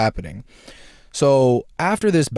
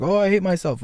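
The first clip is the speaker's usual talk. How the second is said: a hint of Hulk, pitched higher; self-hating Hulk; 7 semitones higher, faster than usual. Hulk punching himself, mild higher despair. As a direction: moan it like a frustrated brute. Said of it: "start punching himself like".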